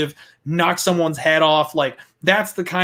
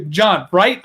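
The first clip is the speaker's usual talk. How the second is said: ordinary talk, nothing marked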